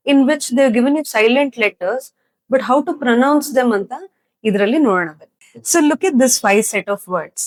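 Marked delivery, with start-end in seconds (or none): none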